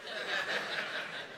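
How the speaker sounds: a quick airy laugh at the joke; breathy laugh. Real, not acted. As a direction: let out a soft breathy laugh.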